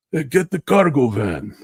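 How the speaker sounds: high, loud mock Italian accent; Italian pizzeria accent, "cargo" stretched and punched high; Italian pizzeria guy. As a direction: bark the order like a pizzeria owner in a hammed-up Italian accent. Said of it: "Italian guy, like a pizzeria".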